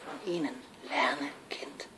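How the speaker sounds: very soft, breath-only temptation; hushed conspiratorial prompting; coaxing whisper. Acted. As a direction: whisper it slyly as secret advice. Eros coaxes the girl.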